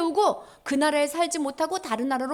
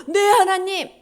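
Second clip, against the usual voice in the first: loud, higher eager answer; obedient reply; 6 semitones higher, 9 dB louder, slower than usual. Acted. Prophet answering God's order, loud obedient reply. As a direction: answer up loudly like a soldier.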